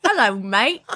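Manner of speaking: chirpy put-on British accent; mock British accent. Joking about the witch's Bible-verse British voice, mock British accent. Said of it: "and being like".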